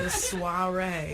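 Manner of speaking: "soiree" drawn out slowly as "swah-RAY"; pronunciation correction. Shown as a demonstration. Guest corrects host's pronunciation.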